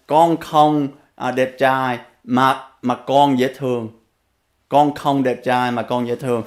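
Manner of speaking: Vietnamese "Con không đẹp trai, mà con dễ thương" said plainly; a child's reply in Vietnamese; speaking Vietnamese. Retold or imitated. Boy answering his father in Vietnamese, plain. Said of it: "in Vietnamese".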